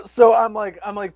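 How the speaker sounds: usual speaking voice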